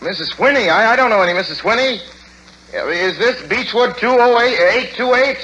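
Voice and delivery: high, stammering and fast, fear building; rising panic. Acted. Son learning a stranger has his mother's phone, panicked. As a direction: grasp for something familiar.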